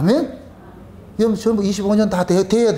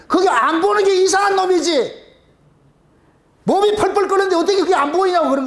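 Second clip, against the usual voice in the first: excited; 10 semitones higher than usual. Real, not acted. Preacher's heated aside on teens.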